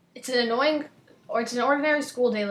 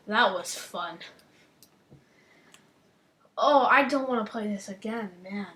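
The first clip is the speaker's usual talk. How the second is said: ordinary delivery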